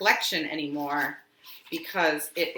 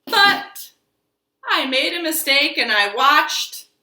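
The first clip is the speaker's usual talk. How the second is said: higher and louder, bouncing storybook voice; reading to children; 4 semitones higher, 4 dB louder than usual. Acted. Teacher reading I Made a Mistake to children, playful and lively. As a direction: ham up each mistake for the kids.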